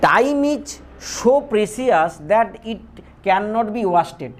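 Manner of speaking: each word spaced out for copying; slow dictation. Shown as a demonstration. Teacher dictating an example sentence to students, slow and deliberate. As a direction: read it out slowly for students to write.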